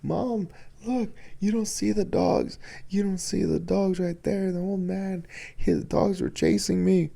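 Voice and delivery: insist, shaken, that the dogs are there; terrified son pointing out the dogs; frightened son. Retold or imitated. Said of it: "and he goes".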